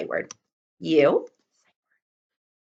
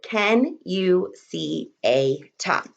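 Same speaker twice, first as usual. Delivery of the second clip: slow dictation; 3 semitones lower, slower than usual. Shown as a demonstration. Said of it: "welcome back".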